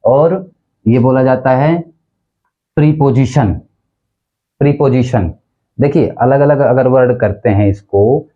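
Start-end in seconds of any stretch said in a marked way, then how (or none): 2.8-5.3 प्रीपोजिशन as PREE-po-ji-shun; प्रीपोजिशन built from "pree" plus "position"; common mispronunciation. Shown as a demonstration. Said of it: "teachers भी गलत प्रयोग करते हैं".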